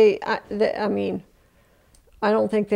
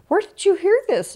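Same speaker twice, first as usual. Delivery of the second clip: high, louder frightened demand; panicked question; 11 semitones higher, 3 dB louder than usual. Retold or imitated. Daughter frantically asking the stranger where she heard of the crash. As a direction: fear pushing the voice up and out.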